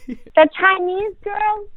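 high, slow indignant echo; incredulous, playfully offended; mock indignant. Real, not acted. Mock offence at being misnamed.

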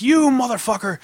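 high-pitched mock yell, drawn on YOU; screaming manager. Irate manager lays into customer, mock screaming. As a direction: imitate a manager's angry scream. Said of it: "he's screaming at me".

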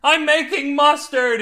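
high, loud, drawn-out scream. A narrator's reading. Husband insisting to doubting wife, screamed. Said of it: "he screamed".

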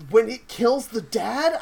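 normal talking voice

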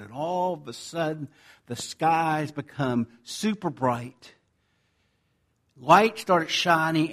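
ordinary talk, nothing marked

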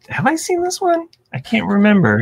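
high, puzzled self-questioning. Acted. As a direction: ask yourself in a high, baffled voice. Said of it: "I'm always like".

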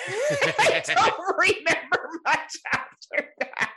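slow, high words broken by laughter; real giggling fit while talking; laughing. Real, not acted. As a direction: barely get it out for laughing. Laughing at own drunken blackout.

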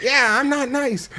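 high, snotty comeback; mock shitty dummy. Dummy answering the offended teacher, snotty and mocking. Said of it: "real shitty to this lady".

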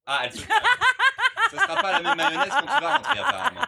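fast, high, laughing tease. Real, not acted. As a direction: giggle through the joke. Teasing through laughter.

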